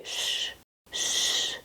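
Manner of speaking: long whispered shh; hushing shh. Acted. Teacher hushing the class with a whispered shh. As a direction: hush them with a soft shh.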